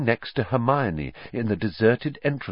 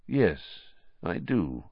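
quiet; 4 semitones lower, 6 dB quieter, slower than usual. A narrator's reading. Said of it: "said Dumbledore quietly".

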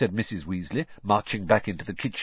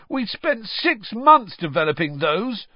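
shouting; 12 semitones higher, 5 dB louder than usual. A narrator's reading. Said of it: "Fred shouted at his mother".

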